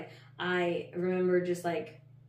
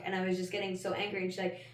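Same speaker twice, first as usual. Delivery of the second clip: ordinary delivery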